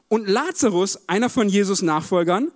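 normal talking voice

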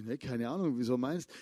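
low, offhand mumble, half-voiced; mumbled "no idea" on the phone; casual low reply. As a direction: shrug it off, clueless and relaxed. Retold phone call, his reply.

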